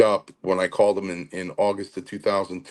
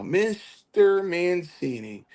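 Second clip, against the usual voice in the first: higher and slow, drawn-out imitation of Chief; Chief's old greeting; 10 semitones higher, much slower than usual. An old mentor greeting his protégé, a slow, higher-voiced imitation. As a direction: stretch the name out fondly, as the old man did. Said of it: "I can hear him say it now".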